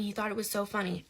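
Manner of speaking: ordinary delivery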